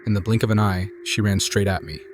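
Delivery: ordinary delivery, nothing marked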